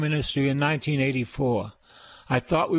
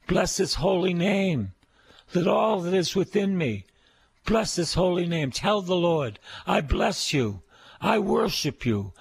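raised, fervent praise, line after line; worship prayer; 5 semitones higher than usual. Acted. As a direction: lead the congregation in adoring prayer.

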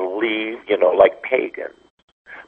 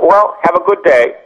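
mock breezy brush-off; 7 semitones higher than usual. Mocking a brushing-off priest.

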